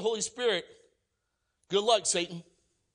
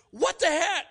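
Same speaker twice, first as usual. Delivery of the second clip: baffled exclamation; 10 semitones higher, 4 dB louder than usual. Retold or imitated. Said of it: "I'm like".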